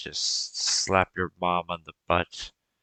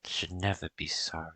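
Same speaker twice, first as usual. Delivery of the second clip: tender comfort; 7 dB quieter than usual. Acted. GM as mother comforting son.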